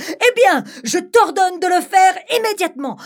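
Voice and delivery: high, loud stern command; commanding bride. Acted. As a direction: snap back the order. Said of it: "rétorqua-t-elle".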